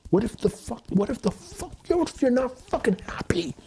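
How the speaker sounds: pitched high and breaking into a whisper; the anger squeezes out as a high, airless cry; strained frustrated whisper. Real, not acted. Questioning the rules of manhood, high strained whisper.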